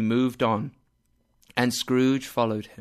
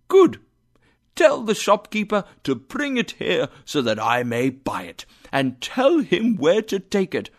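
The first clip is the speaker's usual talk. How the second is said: excited; 7 semitones higher, 4 dB louder than usual. A narrator's reading. Scrooge excited orders.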